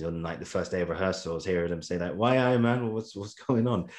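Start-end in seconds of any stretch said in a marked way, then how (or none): 2.1-3.9 higher, mock Geordie greeting; Geordie accent. Coach greeting American kids at first rehearsal, mock Geordie accent. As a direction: put on a playful Geordie accent. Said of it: "a Geordie accent".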